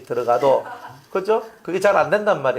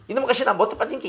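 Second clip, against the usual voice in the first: 이놈의 가시나 못돼 빠진 게 said higher and fast as a fond tease; affectionate teasing; 8 semitones higher, faster than usual. Retold or imitated. Pastor shows loving teasing of little Yeji. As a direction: tease her fondly, quick and bright. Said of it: "예지를 바라보면은".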